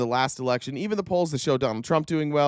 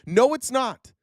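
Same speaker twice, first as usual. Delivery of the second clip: fed-up rant; 6 semitones higher than usual. Real, not acted. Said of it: "I'm so goddamn tired".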